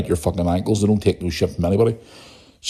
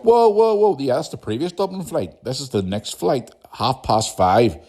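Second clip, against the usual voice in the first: mock exasperated staff; 6 semitones higher, slower than usual. Staff woman voiced in retold row.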